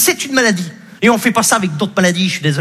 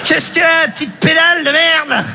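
put-on drunk insulting therapist; 7 semitones higher than usual. Psy ivre insulte l'enfant.

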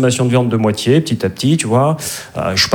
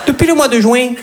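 put-on much higher, singsong Midi accent; southern French accent; 10 semitones higher than usual. Romcom dubbed in Midi accent, much higher southern French accent. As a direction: play a New York romcom lover with a Midi accent. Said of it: "avec l'accent du Midi".

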